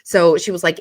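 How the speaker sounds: ordinary talk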